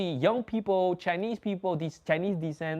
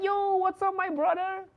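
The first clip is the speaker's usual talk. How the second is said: much higher, bright eager hello; happy greeting. Retold or imitated. Greeting the other Chinese kid, eager and much higher. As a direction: greet him like a long-lost brother. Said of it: "I'm so happy to see him".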